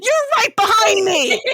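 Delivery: high, loud exclamation; loud, high-pitched comic objection; mock outraged cry. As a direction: yell back in playful protest. Acts a character's protest back.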